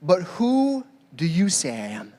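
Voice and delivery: "who" drawn out, the question said slowly and weightily; measured, searching question to his followers; slow earnest question. Shown as a demonstration. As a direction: ask "But who do you say I am?" slowly, as Jesus. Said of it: "Then he asked them".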